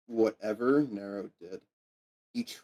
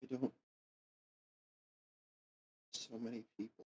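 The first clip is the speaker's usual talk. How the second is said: hushed; 15 dB quieter, faster than usual. Acted. GM voices kid, trailing off.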